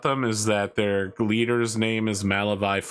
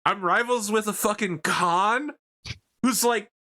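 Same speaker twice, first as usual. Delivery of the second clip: high, incredulous mock voice; baffled at the absurd challenge; high mock disbelief; bewildered soldier; 10 semitones higher than usual. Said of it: "And the dude's like".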